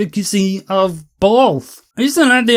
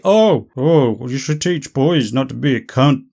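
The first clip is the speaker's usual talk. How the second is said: mocking imitation; 6 semitones lower than usual. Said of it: "You can't just say".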